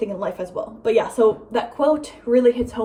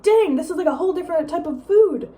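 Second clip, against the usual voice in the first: high, loud re-enacted amazement; wowed, pitched-up exclamation; amazed; 7 semitones higher, 4 dB louder than usual. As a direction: marvel at a new discovery. Retells amazement at real food.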